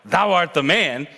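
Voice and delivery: loud, slow, thundering verdict; booming accusation. Shown as a demonstration. Preacher booming the prophet's accusation at David. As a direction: proclaim it like a judge passing sentence. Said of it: "Now, it wasn't like".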